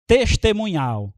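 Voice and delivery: ordinary delivery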